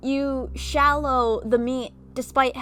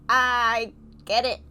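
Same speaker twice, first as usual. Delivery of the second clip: ordinary delivery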